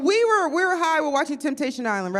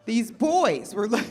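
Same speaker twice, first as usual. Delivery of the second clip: ordinary delivery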